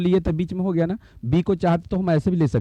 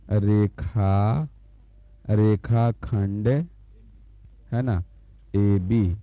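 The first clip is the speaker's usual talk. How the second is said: slow reading aloud; 5 semitones lower, much slower than usual. Shown as a demonstration. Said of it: "तो इसको पढ़ते हैं".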